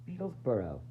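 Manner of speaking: ordinary delivery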